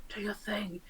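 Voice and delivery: breathy unvoiced whisper to the ring. Player whispering to his magic ring, unvoiced whisper.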